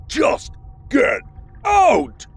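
shrieking high yell on out. A narrator's reading. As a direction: scream him out the door. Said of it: "they yelled".

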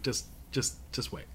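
breathy whisper through held laughter; whispering to a friend at dinner to just wait; whispered aside. Retold or imitated. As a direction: hush her, barely holding in a laugh. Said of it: "I was like".

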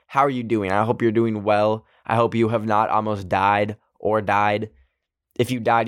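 ordinary delivery

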